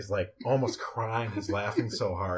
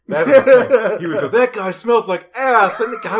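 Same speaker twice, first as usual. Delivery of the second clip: high loud laughing retelling; 11 semitones higher, 15 dB louder than usual. Retold or imitated. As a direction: crack up while quoting him. Retelling rude coworker laughing.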